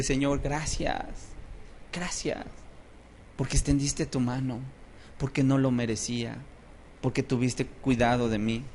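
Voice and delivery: soft, halting prayer of gratitude; understated weeping, softly giving thanks; mock tearful thanks. Worshipper thanking God on their knees, softly acting tearful. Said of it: "la gente llora y llora y llora y dice".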